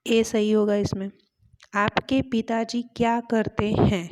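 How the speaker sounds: ordinary talk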